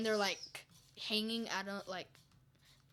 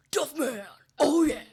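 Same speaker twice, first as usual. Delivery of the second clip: booming, high cartoon mascot; huge punchy catchphrase shout; Duffman; 5 semitones higher, 14 dB louder than usual. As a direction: shout it like a beer-brand mascot. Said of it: "really good at Duffman".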